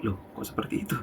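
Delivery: a father's private puzzled thought, half-whispered; softly whispered inner aside; inner thought, hushed. Acted. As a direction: think it aloud under your breath. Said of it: "dalam hatinya si bapak".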